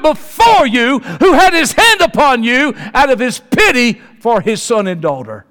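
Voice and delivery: loud, very high shouting sermon; preaching at full force. Acted. Preacher tells the congregation God went before them, shouting and high. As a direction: shout it to the whole church, pleading.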